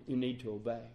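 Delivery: normal talking voice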